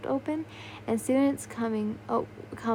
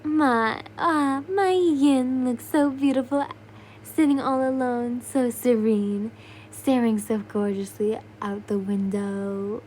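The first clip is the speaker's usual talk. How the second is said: slow, loud doting flirt; flirty admirer; 4 dB louder, slower than usual. Acted. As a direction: croon like a smitten admirer. Doma admires her.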